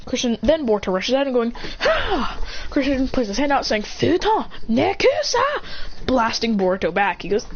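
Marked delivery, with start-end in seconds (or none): 1.8-2.4 high-pitched mock yell; imitated battle cry. Imitated battle cry while rushing. 3.8-5.4 slow, high-pitched mock shout; acted attack call. Calling out a blasting technique.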